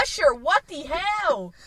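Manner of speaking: loud, drawn-out mock scolding; exasperated call-out. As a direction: call him out, high and slow. Mock scolding of absent celebrity.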